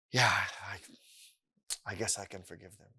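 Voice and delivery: soft, low, hesitant concession; quietly acted grudging agreement; reluctant acceptance. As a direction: give in haltingly, voice dropped. Acts reluctantly agreeing to forgive.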